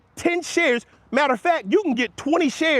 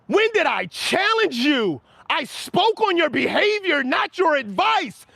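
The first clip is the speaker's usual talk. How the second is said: higher, slower mock reading of a message typed in all caps; mock all-caps yell; 6 semitones higher, slower than usual. Streamer reads Rain's all-caps chat message aloud, mock yelling. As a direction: read it back as if the caps were shouting at you. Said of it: "this is what your last message sounds like".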